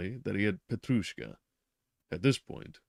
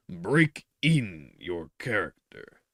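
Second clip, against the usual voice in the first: "break in" very slow and heavy; doctor stressing "break in" significantly; stressed break in; 6 dB louder, slower than usual. A narrator's reading. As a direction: drag out "break in" with weight. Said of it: "laid special stress on the word "break in".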